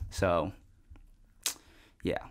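ordinary delivery

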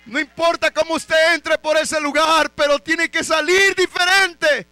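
high, very loud shouted sermon; full-force preaching. Real, not acted. Preacher urging the church not to leave unchanged, full-force preaching. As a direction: thunder it at the congregation, relentlessly.

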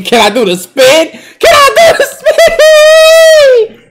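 high, loud, stretched-out laughing scream; screaming excitement. Real, not acted. Streamer begging the game for a spin move, long screaming laugh. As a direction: drag the question out in a screaming laugh.

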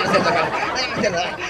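ordinary delivery

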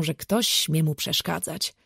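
plain reading voice

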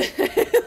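high loud laugh; loud burst of laughter. Real, not acted. Friends laughing at bad landing.

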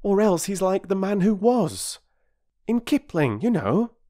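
put-on high, eager young boy voice; a boy keen to show what he knows. Peter voiced, excited.

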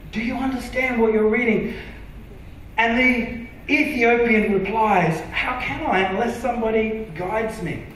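ordinary delivery

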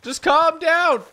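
high, loud, slow drawn-out yell; pleading shout. Real, not acted. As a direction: beg the runaway horse to stop. Yelling at fleeing horse.

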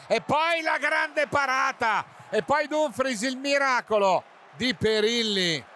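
rising to a shout on the save; excited commentary. Real, not acted. Save on a header, commentator erupts. (telecronaca esaltata)

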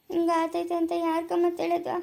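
ordinary delivery

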